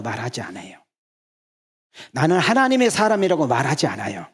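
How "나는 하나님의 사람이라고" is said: higher, louder mock boast; proud claim. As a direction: declare it like a self-proclaimed saint. Preacher voicing a proud believer.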